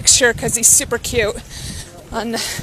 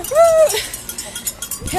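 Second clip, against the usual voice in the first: excited cheer; 12 semitones higher than usual. Real, not acted. Cheering at race start.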